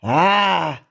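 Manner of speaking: loud, long drawn "aah"; startled roar. A narrator's reading. Stoic enters to find the mess, loud shocked cry. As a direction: bellow out in shock.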